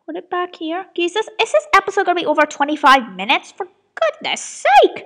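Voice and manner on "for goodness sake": high, loud, drawn-out exasperation; exasperated cry. Real, not acted. Creator facing an overlong episode, exasperated. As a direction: squawk it slowly in disbelief. Upset episode runs too long.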